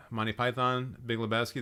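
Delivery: ordinary talk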